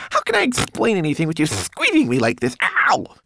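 high, loud, squeezed little voice; squealing indignation. A narrator's reading. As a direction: protest shrilly, ending in a yelp. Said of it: "squealed Twigleg".

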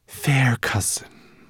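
high, slow, courtly serpent voice; polite sea serpent. A narrator's reading. The serpent addresses her dragon cousin, politely drawn out. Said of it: "addressing the dragon politely".

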